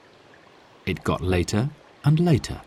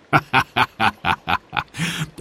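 chuckle; 3 semitones higher than usual. Acted. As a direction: laugh at your own idea. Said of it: "Then Sid began to laugh".

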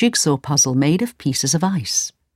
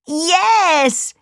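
high, elated exclamation; delighted realisation; 10 semitones higher than usual. A narrator's reading. Kay remembers his home, delighted. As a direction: exclaim as the memory returns.

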